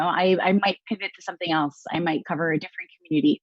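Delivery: ordinary talk, nothing marked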